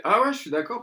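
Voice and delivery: high, loud chirpy agreement; mock eager lady. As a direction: play the keen seller. Imite la dame au chien. (imitation aiguë de la dame enjouée)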